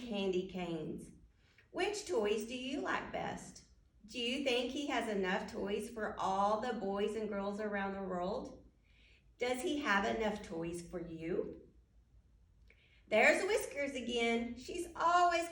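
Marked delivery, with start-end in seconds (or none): none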